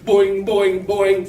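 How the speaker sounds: loud springy boings; playful loud hopping noises; boing sounds. Shown as a demonstration. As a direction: bounce the boings out for the kids.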